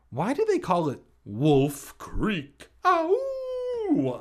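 loud question rising into a high howl; mock wolf howl. Alex asking about Wolf Creek, hammed-up howl. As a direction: draw out the name, then howl high like a wolf.

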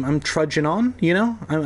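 ordinary delivery

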